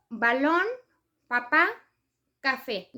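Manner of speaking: "balón, papá, café", each stressed on its final syllable; last-syllable stress. Shown as a demonstration. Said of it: "Su sílaba más fuerte es la última".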